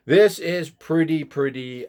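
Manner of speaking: ordinary talk, nothing marked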